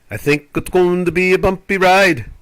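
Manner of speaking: put-on playful British-accented film quote; Harry Potter quote. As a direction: quote it like the Knight Bus scene. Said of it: "as they said in Harry Potter".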